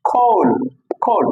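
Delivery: খোল drawn out with aspirated k; corrected pronunciation. Shown as a demonstration. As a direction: say খোল as "khoul". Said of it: "এটা হচ্ছে খোল".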